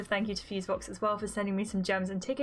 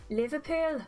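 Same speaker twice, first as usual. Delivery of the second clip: high-pitched Scouse take on "Liverpool"; Liverpool accent; 6 semitones higher than usual. Acted. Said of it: "how to do a Liverpudlian accent".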